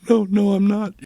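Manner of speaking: retelling the moment he choked up; welling up. Retold or imitated. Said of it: "I started to well up".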